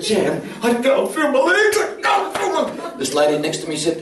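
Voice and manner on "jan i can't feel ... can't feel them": very high, drawn-out mock cry; hammed-up panic. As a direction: cry out to your wife in comic, escalating panic. Reenacting panic to Jan under table.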